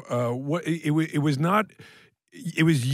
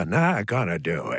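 Jimmy Stewart doing Dana; 11 semitones lower than usual. Retold or imitated. Friend's old party impression, Stewart doing Dana, deep. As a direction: drop way down into the Stewart voice. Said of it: "This is Jimmy Stewart doing Dana".

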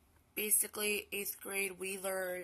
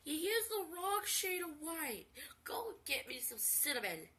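imitating grandma; 8 semitones higher than usual. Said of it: "She's like".

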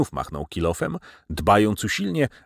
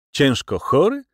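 high, rising echo question; raised, incredulous query; surprised; 7 semitones higher, 4 dB louder than usual. A narrator's reading. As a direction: repeat "ciężko chory" as if astonished. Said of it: "rzekłem ze zdziwieniem".

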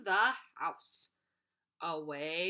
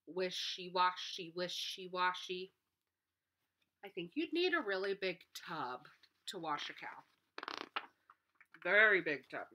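usual speaking voice

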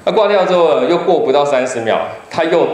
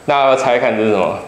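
ordinary talk, nothing marked

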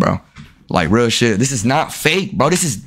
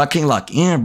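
ordinary talk

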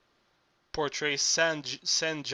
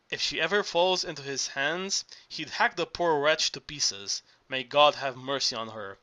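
plain reading voice

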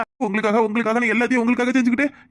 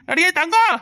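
calling out; 6 semitones higher, slower than usual. Acted. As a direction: shout for her to come quickly. Calling someone urgently.

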